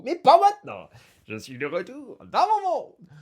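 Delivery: high, loud burst of joy; exultant outburst. Acted. Borel refuses to leave his world now, exultant. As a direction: suddenly whoop it out. Borel rejoices at being home.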